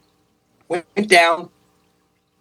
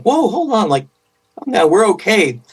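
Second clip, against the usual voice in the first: higher-pitched "whoa, hold on", mildly protesting; retold reassurance; 5 semitones higher than usual. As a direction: imitate yourself trying to halt someone's panic. Father calming his frightened daughter, retold.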